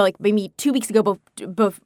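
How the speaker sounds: usual speaking voice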